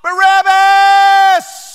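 very high, very loud slow roar; crowd cry. Acted. Thousands crying out to Pilate, huge high roar. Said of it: "Thousands of voices begin to cry out".

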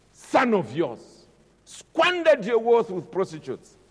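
high, loud aggrieved complaint rising to a shout; resentful son shouting at his dad; angry elder brother. Acted. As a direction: hurl the grievance at the father. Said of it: "He's angry with his father".

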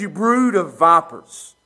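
slow, loud, weighted rebuke; stern denunciation. Acted. Jesus rebuking the Pharisees, slow stern denunciation. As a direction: drive each insult home slowly. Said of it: "what he says".

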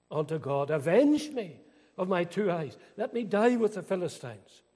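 loud, building sermon climax; full-force preaching. Acted. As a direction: preach it, pressing harder line by line. Dramatic retelling of temple scene.

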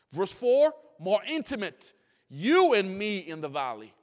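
fervent pulpit cry; full-force preaching. Acted. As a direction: build the congregation up to a climax.

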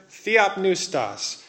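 theopneustos said slowly, syllable by syllable, falling at the end; Greek word said slowly. Shown as a demonstration. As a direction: spell out theopneustos carefully for the class. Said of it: "and then one more word".

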